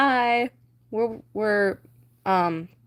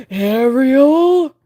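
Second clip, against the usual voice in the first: loud furious scream of her name; 4 semitones higher, 10 dB louder than usual. Acted. King Triton screams for missing Ariel, loud. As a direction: bellow it like an angry king. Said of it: "Triton screams".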